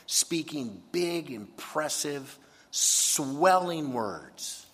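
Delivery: very slow, swelling emphasis; slow, exaggerated. Preacher illustrates boasters' swelling words, very slowly. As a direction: stretch each word out big. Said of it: "incredibly exaggerated".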